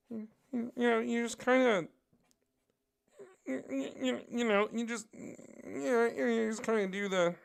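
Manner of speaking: very high, bad Kermit-like impression; Todd McFarlane. Shown as a demonstration. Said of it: "turns into a bad Kermit the Frog".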